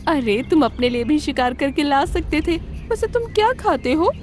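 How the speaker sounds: high fox voice, rising in puzzlement; clever fox. Acted. Fox asks crane what it eats, high fox voice. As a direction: question your servant in a high voice.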